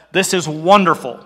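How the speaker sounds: ordinary talk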